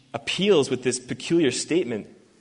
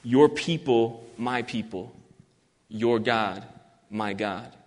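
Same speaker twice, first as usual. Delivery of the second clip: much softer and lower, slowing on "your people"; soft emphasis; 5 semitones lower, 5 dB quieter, slower than usual. Shown as a demonstration. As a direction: let the center of the chiasm sink into a hush. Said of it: "with the emphasis placed on".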